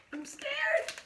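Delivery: high mock-scared squeak. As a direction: squeak it like a frightened child. Listener aside, mock fear.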